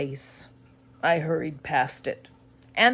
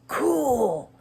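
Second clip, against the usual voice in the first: excited cry; 7 semitones higher, slower than usual. A narrator's reading. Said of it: "Ethan cried".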